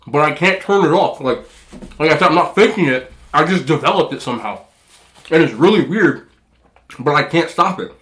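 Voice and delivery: ordinary talk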